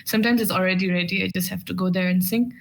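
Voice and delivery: ordinary talk, nothing marked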